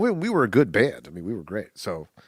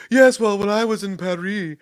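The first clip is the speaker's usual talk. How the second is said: snooty Paris story; 6 semitones higher than usual. Retold or imitated. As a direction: lord it over them with Paris. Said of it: "every interesting story they have is like".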